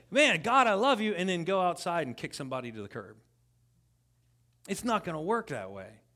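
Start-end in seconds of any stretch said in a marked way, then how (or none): 0.1-1.1 mock gushing. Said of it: "You can't come in here and say".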